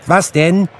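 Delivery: put-on high, loud boyish question; boy's reply. A narrator's reading. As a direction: call back as a young boy. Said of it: "antwortete der Knabe".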